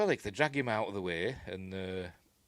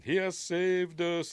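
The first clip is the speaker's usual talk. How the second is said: slow mock alien praise; 10 semitones higher, 4 dB louder, slower than usual. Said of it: "thanking Alexander Dane".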